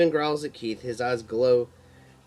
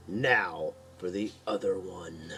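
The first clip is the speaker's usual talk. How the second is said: slow, low, softer angry mutter; 5 semitones lower, 4 dB quieter, slower than usual. A narrator's reading. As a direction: mutter it through gritted teeth. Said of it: "Keith mutters angrily".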